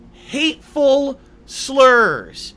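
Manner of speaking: loud, high and very slow; drawn-out mockery. Streamer mocking the accusation against him, loudly drawn out.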